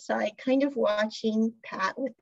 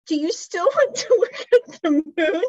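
higher and louder, laughing through the question; laughing tease; 8 semitones higher, 8 dB louder than usual. Retold or imitated. Pran mocking Pat on the rooftop, laughing. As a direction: tease him while cracking up.

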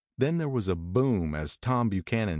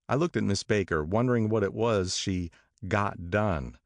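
ordinary delivery, nothing marked

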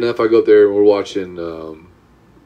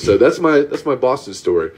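normal talking voice

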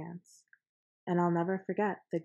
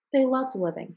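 sudden high, loud plea; loud outburst; 7 semitones higher, 8 dB louder than usual. A narrator's reading. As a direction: burst out higher and louder. Lorax pleads for the Barbaloots.

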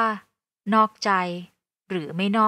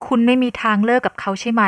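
usual speaking voice

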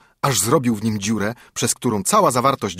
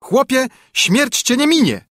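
much higher, louder cry; shrill threat; 14 semitones higher, 5 dB louder than usual. A narrator's reading. Sparrow in the carter's mouth threatens him, shrill and loud. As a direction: shriek it from inside his mouth.

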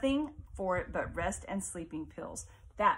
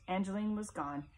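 plain reading voice

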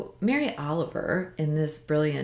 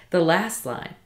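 ordinary talk, nothing marked

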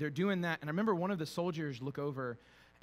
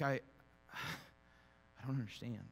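sighing bewilderment; 2 semitones lower, 4 dB quieter, much slower than usual. Retold or imitated. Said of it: "just being like".

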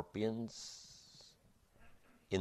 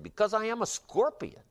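high, matter-of-fact scorpion voice; 14 semitones higher than usual. Acted. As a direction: answer like it's simply your nature. Scorpion answers the dying frog.

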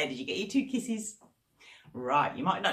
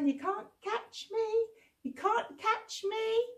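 teasing monkeys; 14 semitones higher than usual. Acted. Monkeys teasing Mister Crocodile, sing-song taunt. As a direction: tease like a cheeky monkey.

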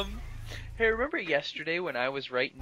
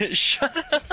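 laughing. Real, not acted. Mocking friend's retort, laughing.

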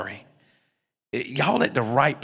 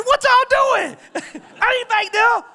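mock indignant; 6 dB louder than usual. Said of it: "I say".

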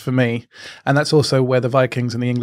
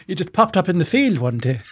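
mock local voice; 6 semitones higher than usual. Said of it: "telling stories".

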